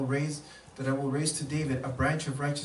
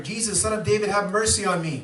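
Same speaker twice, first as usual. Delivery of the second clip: loud, raised plea; a blind man begging louder; crying out louder; 5 semitones higher, 6 dB louder than usual. Acted. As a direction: lift the voice and plead. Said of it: "Speak out even louder".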